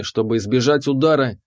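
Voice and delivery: usual speaking voice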